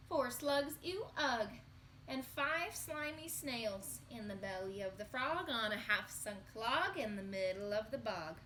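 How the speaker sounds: ordinary talk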